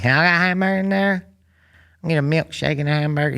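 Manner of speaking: high, rapid-fire mock drive-thru customer; dumb voice. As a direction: imitate dad rattling off an order in a silly high voice. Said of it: "like, a dumb voice".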